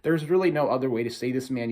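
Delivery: normal talking voice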